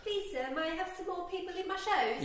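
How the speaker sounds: high, soft pleading orphan; mock-pitiful, high begging; Oliver Twist. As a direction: beg in a small, high orphan voice. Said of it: "I feel like Oliver Twist".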